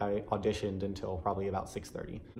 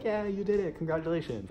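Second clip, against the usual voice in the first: bright cheer pitched well up; cheering on a friend who made it; cheering congratulations; 7 semitones higher than usual. Retold or imitated. Hallway crowd cheering a winner, excited high cheer. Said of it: "everybody would clap and cheer".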